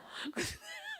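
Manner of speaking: short squealing laugh, high up; embarrassed laugh. Real, not acted. Wife laughing at her own yelling, short high squeal. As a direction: laugh it off, a bit sheepish.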